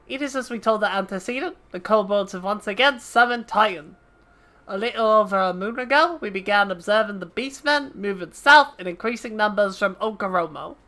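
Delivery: brisk officer's report; understated military briefing; naval officer reading orders. Acted. Voices NPC dialogue.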